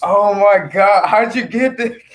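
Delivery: ecstatic, can't take it in; overjoyed. Real, not acted. [name] holds his new puppy, joyful disbelief.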